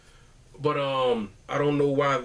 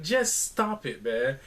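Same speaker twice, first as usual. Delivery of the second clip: exasperated plea; slower than usual. Acted. Fan begging his team to stop the mistakes, exasperated plea. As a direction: stretch each word in weary protest.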